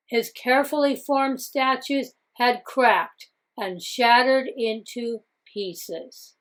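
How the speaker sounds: ordinary delivery, nothing marked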